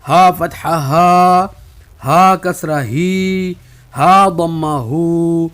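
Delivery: each vowel in ہا فتحہ ہا held long; slow practice drill, stretching ہا ہی ہو; drawn-out drill. Shown as a demonstration. Teacher drilling students slowly, vowels stretched. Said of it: "ابھی ہم تھوڑا کھینچ رہے ہیں".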